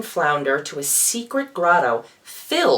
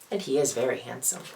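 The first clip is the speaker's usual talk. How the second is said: aside; 6 semitones lower, 10 dB quieter, much faster than usual. Real, not acted. Reader's own aside.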